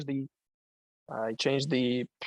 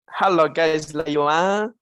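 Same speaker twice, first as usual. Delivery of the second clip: put-on Malaysian accent; 5 dB louder, slower than usual. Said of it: "That's how they speak it".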